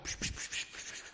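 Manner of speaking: soft unvoiced shushing; gentle psch psch to cut off the apology; father's hushing. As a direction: hush him like a parent calming a child. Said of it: "der Vater macht".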